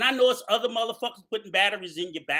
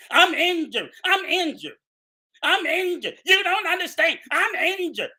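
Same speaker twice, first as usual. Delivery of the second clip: higher, mocking whimper of a fake victim; mock injured whine; 5 semitones higher than usual. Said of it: "his feigned injury".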